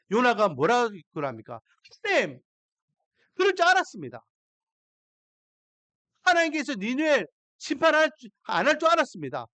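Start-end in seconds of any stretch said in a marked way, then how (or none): 2.0-9.5 higher, louder sulky grumbling to God; Jonah complaining. Acted. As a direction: let Jonah's resentment spill out. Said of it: "불만을 토로해요". (하나님께 불만을 토로하는 요나, 높고 큰 목소리로)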